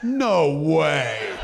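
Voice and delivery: can't believe it, laughing. Real, not acted. Laughing at Tracy's repeated bit.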